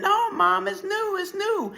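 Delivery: put-on very high, insistent child's objection; acted urgent protest; desperate protest. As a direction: plead it at a high pitch.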